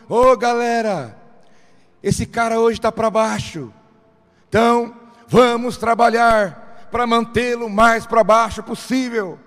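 hammed-up foreman shouting to his crew; mock rally call, a bit sung at the end; nerves rallying the crowd. Nerves voiced calling the crew.

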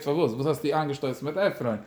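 ordinary talk, nothing marked

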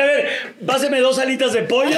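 high, laughing order; mock waiter order. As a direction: order it playfully. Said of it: "yo dije".

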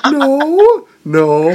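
high loud no falling away; mock guilty denial. Owner denying cheating to her dogs, high guilty no. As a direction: deny it guiltily.

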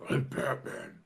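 low gravelly Batman line. Retold or imitated. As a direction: drop the voice way down for Batman. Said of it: "he talked that way the whole fucking time".

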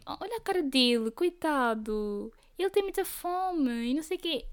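voz aguda e arrastada de dó; irmã com pena do cão. Retold or imitated. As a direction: imitar a irmã a derreter-se pelo cão. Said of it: "Ela diz sempre". (irmã com pena, voz aguda e arrastada)

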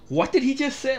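high-pitched incredulous retort, imitating shock; mock shock. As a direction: act out a baffled, shocked listener. Cornette's shocked reaction acted out.

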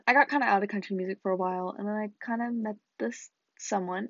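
usual speaking voice

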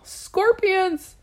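high, choked cry that barely gets out; a yell that catches in the throat; frozen with fear, the shout comes out high; strangled yell. Retold or imitated. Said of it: "I wanted to yell".

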